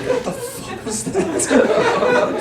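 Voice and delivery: high, slow, stretched-out squeak; baffled disbelief. Retold or imitated. Brother left alone after sister's outburst, baffled squeak. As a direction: drag it out in stunned bewilderment.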